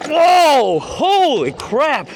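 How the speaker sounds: loud, high shock as the front lifts; startled whoa. Real, not acted. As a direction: gasp it out in real surprise. Front wheel lifts on jump.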